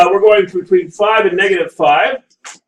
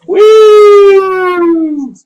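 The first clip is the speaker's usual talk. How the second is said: high, loud whoop of delight; cheer; 12 semitones higher, 6 dB louder than usual. Real, not acted. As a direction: celebrate finishing the graph with a whoop. Teacher cheers finishing graph.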